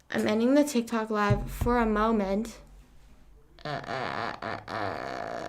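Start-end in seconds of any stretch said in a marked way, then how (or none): 3.6-5.4 very low slow distorted uh uh; goofy deep slowed-down grunting; deep mock grunts. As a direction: growl out deep uhs like a slowed voice.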